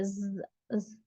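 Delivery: quiet hissing "ज-" sound; soft hiss demo. Shown as a demonstration. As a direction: breathe out a light hissing "ज-". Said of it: "हल्की सी सीटी की आवाज".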